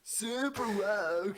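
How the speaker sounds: slow, high stoner drawl; mocking surfer voice. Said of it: "I love, uh, the mocking voice".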